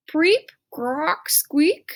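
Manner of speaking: high rising froggy preep, croak, squeak; frog calls. Retold or imitated. Stink practising frog calls, high and rising. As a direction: chirp them out like little frogs. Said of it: "frog sounds Stink studies".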